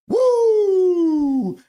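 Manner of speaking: high, loud whooping woo; woo cheer. Retold or imitated. Streamer acting out chat, loud whoop. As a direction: let out a big fan whoop. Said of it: "Sensei D Y is like".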